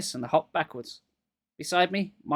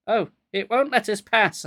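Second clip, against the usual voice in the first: stammered; 6 semitones higher, 6 dB louder than usual. A narrator's reading. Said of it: "I stammered".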